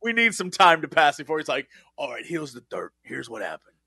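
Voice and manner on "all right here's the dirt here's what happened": confidential low voice. Retold or imitated. Insider finally spilling secrets.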